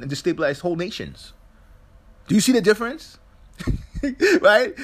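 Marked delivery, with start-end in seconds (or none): 2.3-4.8 high, loud, laughing disbelief; laughing rhetorical question; incredulous. Real, not acted. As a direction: ask it like it's absurd.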